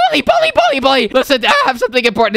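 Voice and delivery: high, insistent name-calling; rapid-fire, high-pitched hail; urgent calling. Acted. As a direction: call out frantically for a friend. Calling his friend urgently.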